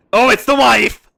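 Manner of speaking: loud burst of recognition; loud, thrilled alarm; excited realisation. Real, not acted. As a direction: exclaim it as it hits you. Excited realisation on seeing the wife.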